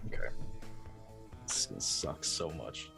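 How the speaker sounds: ordinary talk, nothing marked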